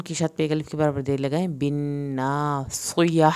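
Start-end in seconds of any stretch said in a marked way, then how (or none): 1.5-3.2 "بالناصیہ" slow, ending on a breathy h; audible final h. Shown as a demonstration. Teacher to students: slow, final h. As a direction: let the h sound at the end of "بالناصیہ". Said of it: "آخر میں ہا کی آواز نکلے گی".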